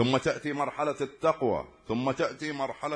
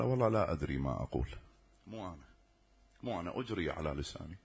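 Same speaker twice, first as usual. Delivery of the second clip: fast, lower, hushed; 8 semitones lower, 8 dB quieter, faster than usual. Retold or imitated. Child unsure of his own words.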